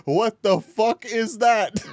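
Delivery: slow, high incredulous question; stretched-out baffled exclamation; amused disbelief. Real, not acted. Reacting to something just shown.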